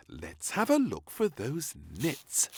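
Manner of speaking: maid voice. Acted. Maid voice, slow.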